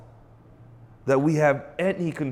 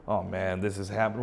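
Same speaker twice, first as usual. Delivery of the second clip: lower, softer grumbling sigh; mock groaning grumbler; 8 semitones lower, 7 dB quieter than usual. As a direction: moan like someone who gave up. Voicing a grumbler.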